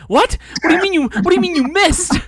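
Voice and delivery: high, loud incredulous outburst; retold alarm. Recruit overhearing a botched blood draw, high-pitched alarmed disbelief. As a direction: squawk it in disbelief.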